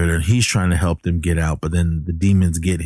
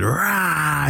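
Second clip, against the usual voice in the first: mock demon roar; 9 semitones higher than usual. Demon kid bursts in.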